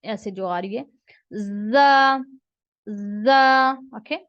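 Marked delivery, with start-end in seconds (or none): 1.3-3.7 loud "जा" with a light hiss; teacher drills "जा" loudly; letter demo. Shown as a demonstration. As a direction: model "जा" loudly and clearly. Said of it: "हल्की सी सीटी की आवाज".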